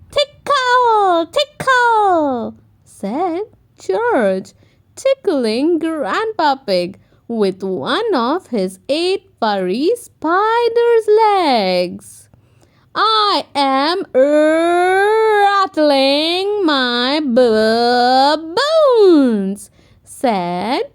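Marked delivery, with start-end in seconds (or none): 0.1-2.6 playful tickling. A narrator's reading. George tickling Grandpa. 13.0-19.7 slow, loud spooky skeleton boast. A narrator's reading. Peppa in a skeleton costume, slow and loud.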